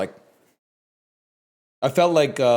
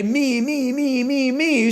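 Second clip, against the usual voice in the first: mimicking chat; 12 semitones higher, slower than usual. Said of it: "you're gonna all say".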